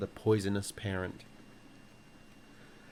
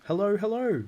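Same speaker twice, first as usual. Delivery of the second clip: very high, loud parrot call; insistent bird greeting, pitched way up; 11 semitones higher, 6 dB louder than usual. A narrator's reading. Parrot wedged up the chimney keeps calling, shrieky greeting. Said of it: "the bird called down to her".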